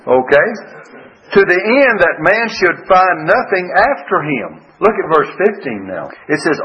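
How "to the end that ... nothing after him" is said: slow, higher solemn reading voice; scripture reading. Acted. Preacher reading a verse to the congregation, solemn. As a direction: lift the verse up slowly like scripture.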